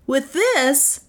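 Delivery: high-pitched, showy inventor; boastful inventor claim. A narrator's reading. Inventor brandishes his baton before onlookers, boastful. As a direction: brag in a high voice. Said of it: "he claimed".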